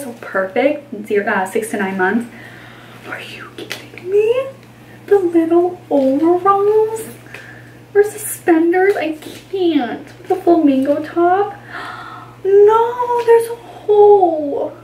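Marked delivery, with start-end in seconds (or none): 3.1-4.5 high breathless whisper of delight; excited whisper. Real, not acted. Mom-to-be whispering over tiny overalls, delighted. Gushing over baby clothes. 5.1-11.6 gushing. Real, not acted. Gushing over overalls. 12.4-14.8 high, loud, dragged-out no; dismayed cry. Real, not acted. Mom-to-be finding a hole in the outfit, dismayed.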